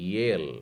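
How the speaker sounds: येल with light stress on य. Shown as a demonstration. Teacher shows the stress for students. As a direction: lean a little on य in येल. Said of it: "य पे थोड़ा सा जोर दे करके".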